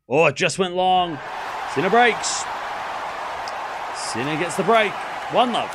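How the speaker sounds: loud high shout as the break lands; excited shout. Real, not acted. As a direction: yell it as the point is won. Break point won.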